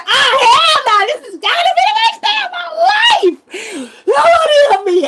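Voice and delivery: high, loud, half-laughing wail; panicked crying shout. Retold or imitated. Woman fleeing her attackers, shouting in high tearful panic. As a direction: cry out in terror, laughing at it.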